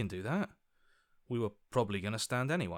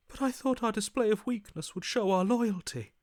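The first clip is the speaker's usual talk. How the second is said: bewildered protest; 10 semitones higher, 4 dB louder than usual. Acted.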